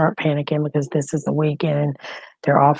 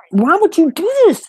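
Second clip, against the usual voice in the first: much higher and louder, anguished; distraught cry; 12 semitones higher, 7 dB louder than usual. Real, not acted. Grandmother crying out at her daughter who hung up, distraught. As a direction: wail it at the phone. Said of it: "I'm fixing to stress out".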